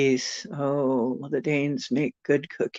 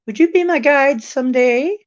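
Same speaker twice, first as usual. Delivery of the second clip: eager; 9 semitones higher, 6 dB louder than usual. Real, not acted. Eager request to viewer.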